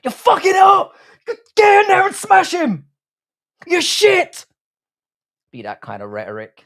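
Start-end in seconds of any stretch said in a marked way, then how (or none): 0.0-4.5 furious high-pitched, full-volume screaming; Gordon Ramsay as corner man. Acted. Imagining a chef coaching a fighter from the corner, enraged shouting. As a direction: scream abuse like a raging cornerman. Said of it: "Imagine Gordon Ramsay as a corner man".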